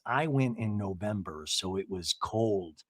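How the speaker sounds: low, soft, slow man's voice; Eddie. Retold or imitated. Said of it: "And Eddie goes".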